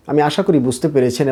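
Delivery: usual speaking voice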